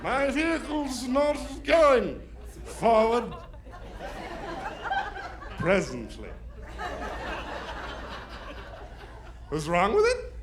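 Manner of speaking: put-on overly formal posh-sounding drunk; slow, careful fake grown-up voice; sober voice. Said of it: "I had the best sober voice".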